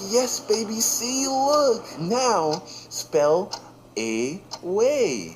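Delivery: put-on high, sweet baby talk; hammed-up doting mom; cooing mom. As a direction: coax him along like a doting mom.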